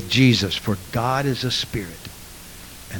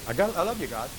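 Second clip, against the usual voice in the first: high, softer, offhand "I know you love me"; mock dull worship; 6 semitones higher, 11 dB quieter, faster than usual. Preacher mocks emotionless worshippers, offhand and soft. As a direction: toss it off like a bored believer. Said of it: "Or do you say".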